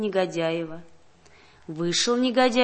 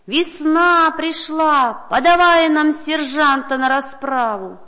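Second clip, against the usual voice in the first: slow, loud, higher crowd roar; crowd shouting; 5 semitones higher, 7 dB louder, slower than usual. A narrator's reading. Crowd demanding the sergeant from the governor, loud slow roar. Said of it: "кричат".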